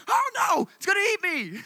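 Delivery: hammed-up scared little brother; imitating a kid shrieking about maggots; mock kid panic. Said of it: "he'd be like".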